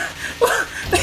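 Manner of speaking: silent wheezing laugh; breathy laugh. Real, not acted. Gamer laughing at a double-teamed opponent, wheezy laugh. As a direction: laugh without voice.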